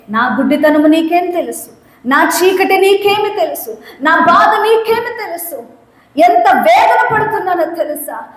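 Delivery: rising high pleading; acted lament of the blind man; anguished protest. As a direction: pour out the pain. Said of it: "blind man protests".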